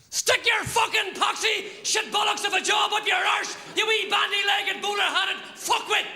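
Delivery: high-pitched shouted insult, every word punched; hammered-out, full-voiced abuse; furious tirade. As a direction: explode at the boss in a rising rant. Acted furious quitting of a job.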